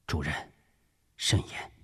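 hushed, low word of caution; low murmur. Acted. Officer cautioning his director near a closed door, murmured. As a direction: keep it down, a discreet warning. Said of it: "低语道".

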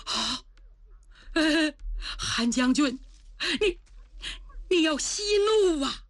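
put-on flustered old minister begging; alarmed minister urging the general to calm down; frightened pleading. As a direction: stammer out a plea for calm. Father pleading with angry general.

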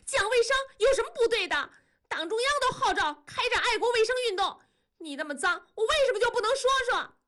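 very high, fast indignant screech; shrill shriek. A narrator's reading. Wife defends her cleanliness to husband, shrill protest. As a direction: pitch it way up and rush it. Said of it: "文丽尖着嗓子叫".

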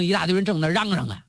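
ordinary delivery, nothing marked

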